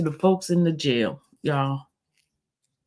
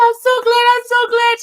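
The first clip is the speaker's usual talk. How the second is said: very high mock-crying squeal; tearful gladness; 5 dB louder than usual. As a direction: imitate her squealing with tearful relief. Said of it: "She started crying".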